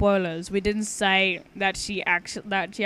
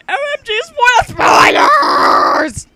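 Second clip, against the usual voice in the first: loud high excited yell; excited shout; 16 dB louder, slower than usual. Real, not acted. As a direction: scream it like a fangirl. Friend shouts about spoilers.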